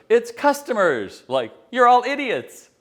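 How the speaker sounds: loud, high, slow mock boss rant; boss insulting everyone. Boss sneering at the customers, loud and high. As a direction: spit out the boss's contempt, high and loud. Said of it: "And finally he goes".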